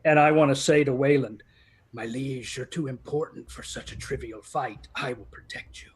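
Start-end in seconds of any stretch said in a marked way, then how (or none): 1.9-5.9 hushed loyal vow. Acted. Adventurer to his liege Wayland, hushed loyal vow. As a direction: speak quietly and earnestly to your lord. Character vows to protect lord.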